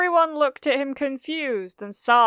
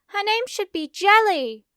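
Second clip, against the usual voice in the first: bright high-pitched schoolgirl; high girl voice; 12 semitones higher, 5 dB louder than usual. Acted. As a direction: lift the pitch way up for Hagakure. Narrator voices Hagakure.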